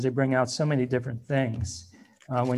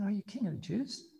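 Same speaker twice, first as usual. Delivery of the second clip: amused Pilate; 5 semitones higher, 10 dB quieter than usual. Shown as a demonstration. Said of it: "I always try to get voice inflection in there".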